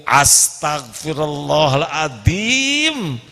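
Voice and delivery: drawn-out exclamation. Shown as a demonstration. Imitating an exasperated mother, very slow drawn-out sigh. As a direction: stretch it out very slowly. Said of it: "Itu mah kemarahan ibu-ibu".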